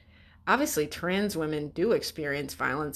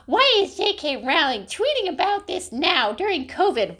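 high, loud mocking whine; mocking dumb critic; 11 semitones higher, 5 dB louder than usual. Clueless critic mocked in a high whine. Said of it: "dumb shits during covid be like".

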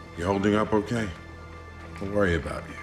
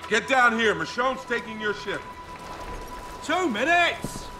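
calling out; 12 semitones higher than usual. Acted. Calling Oak down from above.

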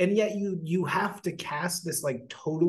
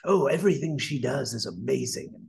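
lightly voiced mock gushing praise; sarcastic imitation of starry-eyed admirers; 3 dB louder than usual. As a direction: parody the fawning fans, a bit louder. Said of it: "It's all like".

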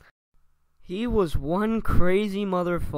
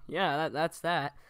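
ordinary talk, nothing marked

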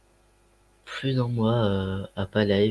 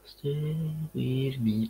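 slow, softer dramatic English line; teasing the chat, slow and hushed; mock dramatic; 3 semitones higher, 6 dB quieter, much slower than usual. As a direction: stretch it out like a movie teaser. Streamer stalls chat while searching.

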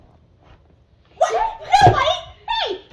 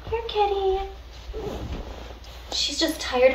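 ordinary delivery

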